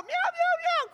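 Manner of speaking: high meow imitation; kitten mewing. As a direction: mew like a small kitten.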